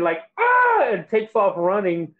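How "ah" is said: a very high startled shriek; shocked shriek. Retold or imitated. A wife sees him naked and shrieks high. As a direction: shriek high as she covers her eyes. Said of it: "covers her eyes and goes".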